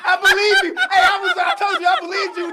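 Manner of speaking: high, loud, laughing yell; laughing outburst. Real, not acted. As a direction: shout it high through laughter. Cracking up at the joke.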